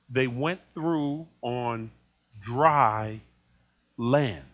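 "dry land" said slowly and low; slow emphasis. Real, not acted. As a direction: lean hard on dry land. Preacher stressing dry land.

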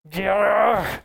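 exasperated "yargh" noise; frustrated growl. Acted. Preacher acting out how we feel when frustrated, exasperated growl. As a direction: let out a short growl of mounting frustration.

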